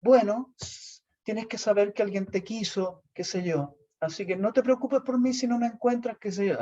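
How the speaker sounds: high, mock-tragic farewell; milk the drama of disappearing; hammed-up melodramatic plea; theatrical martyr. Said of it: "eran unas actuaciones".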